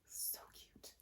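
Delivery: whispered aside, unvoiced; so cute, whispered to the cards. Real, not acted. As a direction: breathe it out as a secret. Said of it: "whispered aside about cards".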